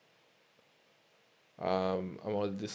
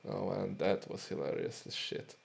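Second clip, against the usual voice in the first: normal talking voice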